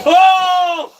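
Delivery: 높은 소리로 깜짝 놀라 외침; shocked shout. Real, not acted. Streamer reacts to surprise move.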